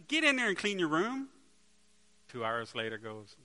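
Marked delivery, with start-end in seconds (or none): 0.1-1.3 rising, louder exasperated command; fed-up dad yelling at his son; loud dad order. Acted. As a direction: yell it down the hall at a stalling kid.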